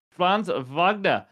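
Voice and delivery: Franz Wagner in a put-on German accent, slow and drawn out. Host redoes the player's name properly, in a German accent. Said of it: "I didn't do my German accent".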